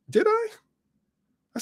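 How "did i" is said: very high-pitched feigned surprise; mock innocence. Man accused of threatening someone, playing innocent. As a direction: play innocent, pitch shooting right up.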